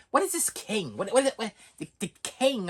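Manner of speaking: high-pitched, stuttering mock confusion; baffled wife. Wife questions husband about this king, high and baffled. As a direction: imitate a wife thrown by the news.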